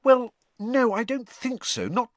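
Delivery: high, halting and uneasy; awkward. A narrator's reading. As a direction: squirm out of the answer. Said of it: "said Hermione awkwardly".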